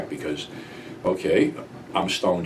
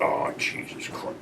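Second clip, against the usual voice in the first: dismayed whisper; 8 semitones lower, 12 dB quieter, slower than usual. Retold or imitated. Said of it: "I'm like".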